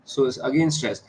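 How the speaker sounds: usual speaking voice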